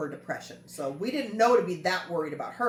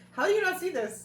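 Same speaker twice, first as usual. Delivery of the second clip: incredulous; 12 semitones higher than usual. Retold or imitated. Mocking other people's blindness.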